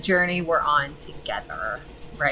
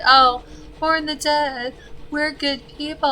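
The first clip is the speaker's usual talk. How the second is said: higher, slow, sing-song pious mock of his own words; mocking himself; 10 semitones higher, 4 dB louder than usual. Gamer mocking his earlier noble plan, sarcastic sing-song. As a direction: send up your own naive goodness. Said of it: "I was like".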